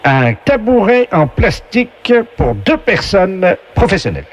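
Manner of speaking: slow, booming quiz-show host; game show announcer voice. Acted. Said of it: "la voix du jeu".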